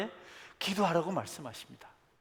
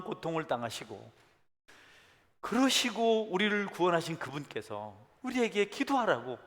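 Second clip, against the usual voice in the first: normal talking voice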